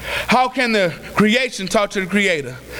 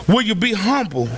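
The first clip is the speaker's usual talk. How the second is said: ordinary talk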